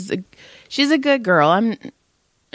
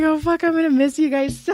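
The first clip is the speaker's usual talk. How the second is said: laughing and crying; 6 semitones higher than usual. Real, not acted. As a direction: say it while helplessly laugh-crying. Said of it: "I started laughing and crying more".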